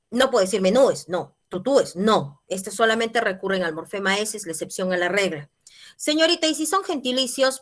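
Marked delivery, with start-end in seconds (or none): 6.0-7.6 higher-pitched pupil calling to teacher; student's question. Acted. Student asks teacher about gentilicios, higher questioning voice. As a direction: lightly imitate a student raising a query.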